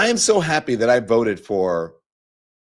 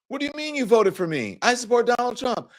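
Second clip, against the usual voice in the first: mock high-pitched indignant Trump fan; MAGA supporter; 13 semitones higher than usual. Trump supporter objecting to the speaker's vote, mock indignation. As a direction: imitate an affronted supporter, pitched way up. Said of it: "there were some maga folks".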